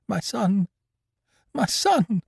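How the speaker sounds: high, panicked cry of a mother; stammered in terror. Acted. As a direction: gasp it out in sudden fear. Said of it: "she stammered".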